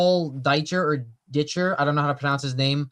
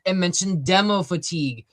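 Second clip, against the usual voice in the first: normal talking voice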